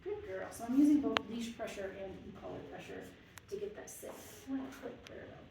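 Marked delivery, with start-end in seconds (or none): 4.5-5.4 soft whisper of praise. Real, not acted. Trainer whispering praise to a calm dog. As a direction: breathe the praise softly.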